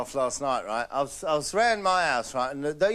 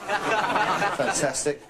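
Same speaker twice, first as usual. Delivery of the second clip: drawn-out fantastic; much slower than usual. Acted. Drawn out exclamation.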